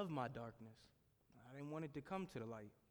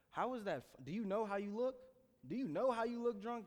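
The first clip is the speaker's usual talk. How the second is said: put-on higher, louder jabs at drunk friends; mocking disbelief; 8 semitones higher, 5 dB louder than usual. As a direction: needle them, each question pushing harder.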